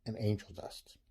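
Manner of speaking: soft whisper, hushed and quick; whispered aside. Acted. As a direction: slip it in like fine print. Ad whispers the fine print.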